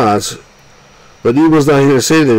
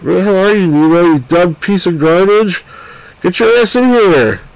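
exasperated yell, pitched up; angry yelling; 5 semitones higher than usual. Real, not acted. Player yelling at absent Nemo, exasperated. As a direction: chew out the missing captain. Yelling at missing game character.

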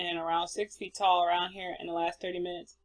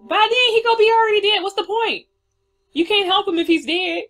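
high, fast furious yelling; outraged shout; 13 semitones higher, 12 dB louder than usual. Acted. Player yelling at the policeman who won't help, high furious shout. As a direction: explode at the lazy cop.

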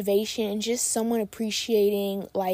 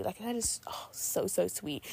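touched, whispered aside; 8 dB quieter, slower than usual. Real, not acted. Host whispering thanks to a listener, touched. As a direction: whisper it, moved.

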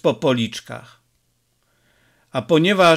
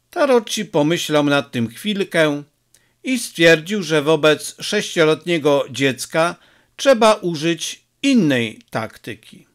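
ordinary delivery, nothing marked

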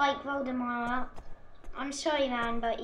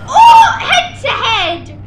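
loud, laughing yelp of delight; high, loud cry of glee that laughs through; excited laughing shout; 7 semitones higher, 13 dB louder, slower than usual. Real, not acted. Excited clash in the game.

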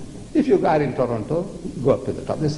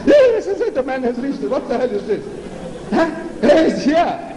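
alarmed God; 7 semitones higher than usual. Retold or imitated. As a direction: shriek in alarm as the man breaks in. God panics as man arrives.

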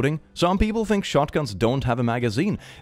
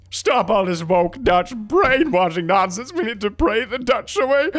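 high-pitched, rising crusading cry; hammed-up fanatic pleading for prayer; mock zealot outrage; 10 semitones higher than usual. Nationalist urging everyone to pray the Dutch away, mock fervor.

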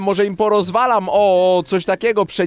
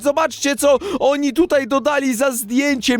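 normal talking voice

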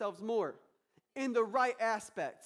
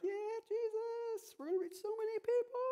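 high, put-on eager voice; hammed-up starry-eyed believer, much higher; mock naive hype. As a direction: parody blind optimism, much higher. Mocking naive church planters.

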